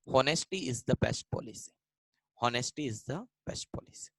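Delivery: slow and soft, word by word; slow dictation. Shown as a demonstration. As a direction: read it out slowly to the class. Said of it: "teacher dictating example sentence".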